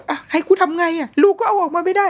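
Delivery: high-pitched, flustered retort; exasperated go-between. Retold or imitated. Mother caught between stressed husband and crying child, exasperated. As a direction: throw up a high, helpless protest. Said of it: "อีกคนขับก็น่าเครียด แล้วเราคือคนกลางไง".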